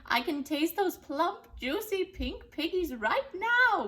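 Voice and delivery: a greedy witch relishing the words; high, loud drawn-out witch delight; gleeful witch voice. Acted. Gritch the witch voiced in story.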